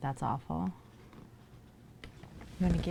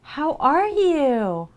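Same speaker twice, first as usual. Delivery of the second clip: high, loud, very slow singsong hello; much higher, louder, stretched-out how are you; drawn-out excited greeting; 11 semitones higher, 13 dB louder, much slower than usual. Real, not acted. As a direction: stretch it out gushing at a friend.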